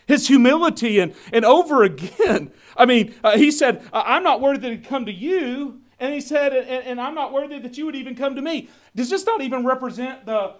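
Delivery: loud, driving sermon delivery; booming pulpit voice; full-force preaching. Acted. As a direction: preach it out to the congregation.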